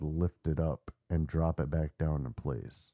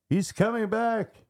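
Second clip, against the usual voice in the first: very high-pitched mock scream; 3 dB louder than usual. Narrator imitates possessed boy waking at night, screaming out. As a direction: cry out in a high, strained alarm. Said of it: "started screaming out".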